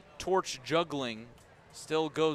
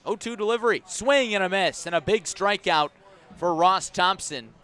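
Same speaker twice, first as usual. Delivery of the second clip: higher and louder, rising to the strikeout; excited strikeout call; 5 semitones higher, 7 dB louder than usual. Real, not acted. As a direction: call the strikeout with a burst of energy.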